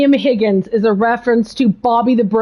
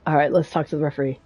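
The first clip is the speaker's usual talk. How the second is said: quiet aside; 10 semitones lower, 8 dB quieter, much faster than usual. Real, not acted.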